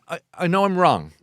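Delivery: normal talking voice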